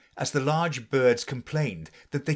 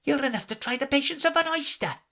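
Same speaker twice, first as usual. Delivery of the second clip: much higher, exasperated voice; young crab; faster than usual. A narrator's reading. Young crab grumbling at her mother, exasperated. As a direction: roll your eyes at her.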